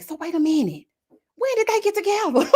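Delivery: high, disbelieving gasp of a question; playing it scandalized; shocked. Imagined reaction at funeral.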